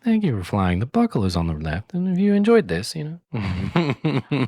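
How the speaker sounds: playful imitation of a flight attendant announcement; chirpy cabin announcement, pitch jumping up. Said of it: "sound like a flight attendant".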